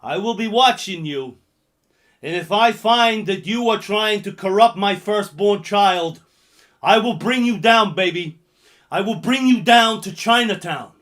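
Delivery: loud threatening movie line; hammed-up loud threat; loud menacing quote. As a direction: boom the threat at them. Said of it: "number five goes like this".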